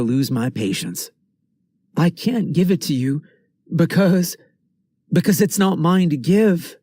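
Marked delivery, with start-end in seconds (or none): 2.0-6.8 lightly voiced scared kid; a hint of a frightened boy, higher; nervous boy voice. Boy pleading with adult.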